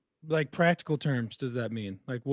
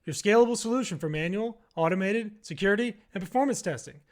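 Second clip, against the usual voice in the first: bright, higher sponsor read; 6 semitones higher than usual. Acted. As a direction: pitch the sponsor like a radio spot. Sponsor read before the show.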